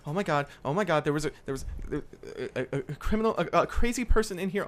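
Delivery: fast, high, stammering panic; freaking out. Acted. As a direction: babble in a frightened rush. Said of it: "like, freaking out".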